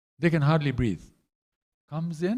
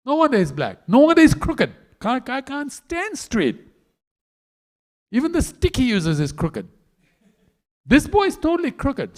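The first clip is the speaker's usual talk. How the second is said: high, loud, indignant rant; furious Radharani; 9 semitones higher, 6 dB louder than usual. Acted. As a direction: pile on the insults, pitch climbing. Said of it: "She's getting really mad".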